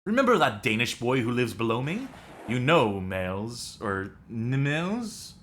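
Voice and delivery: put-on hammy French accent reading a mission briefing; over-the-top French accent, fully committed. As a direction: play it like a French Resistance handler. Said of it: "This is my French accent".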